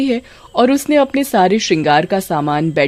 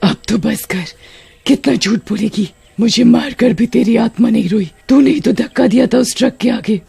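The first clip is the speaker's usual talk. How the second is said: angry; 4 semitones higher than usual. Acted. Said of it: "गुस्सा आ जाता है".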